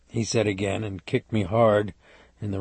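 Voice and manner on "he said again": plain reading voice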